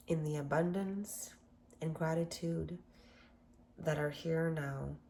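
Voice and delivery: guided meditation. Acted. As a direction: gently guide listeners through affirmations, unhurried. Leads a gratitude meditation.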